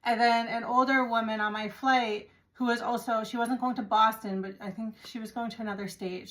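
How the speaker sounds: ordinary talk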